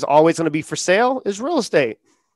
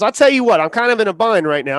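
lightly voiced Southern country seller; Southern accent; 5 semitones higher, 4 dB louder than usual. Country landowner to a homeless buyer, in a light Southern accent. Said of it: "he has, like, a Southern accent".

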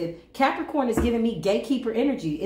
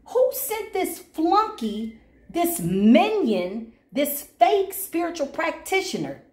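incredulous scolding; 7 semitones higher than usual. Retold or imitated. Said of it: "Oh, I'm like".

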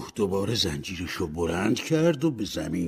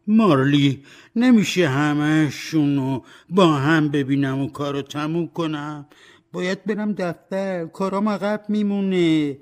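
loud, higher wheedling plea; bargaining Scrooge; 7 semitones higher, 6 dB louder than usual. Acted. Scrooge bargains with Marley to see all spirits at once. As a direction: try to haggle your way out.

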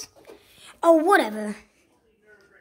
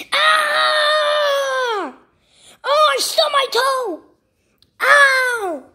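high yell of pain; acted pain; 8 semitones higher, 3 dB louder, much slower than usual. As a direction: cry out as if you just stubbed your toe. Character stubs a toe.

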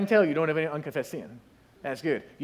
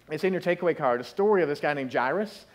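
usual speaking voice

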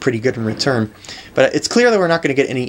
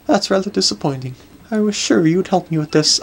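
lightly voiced lady, a bit higher; disappointed grand lady; 7 semitones higher than usual. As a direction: mildly reproach like a grand dame.

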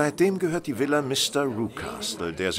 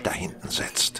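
whispered, unvoiced; 4 dB quieter than usual. Holmes whispers to Watson about the stranger, unvoiced hush. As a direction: drop to a secret whisper. Pointing out man discreetly.

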